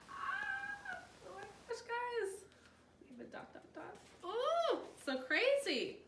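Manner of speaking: thrilled shriek at the twist; excited squeal. Real, not acted. Reader gasps at the plot twist, squealing.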